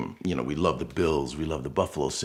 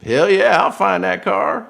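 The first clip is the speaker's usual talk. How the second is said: put-on loud, high, animated Southern good ol' boy, slowing on the second line; hammed-up Southern private eye cheering; Southern accent; 12 dB louder, slower than usual. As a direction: whoop it out in a thick Southern drawl. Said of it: "Very Southern accent, very, very, um, animated person".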